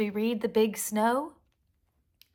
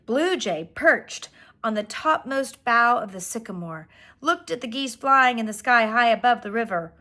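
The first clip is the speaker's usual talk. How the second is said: plain reading voice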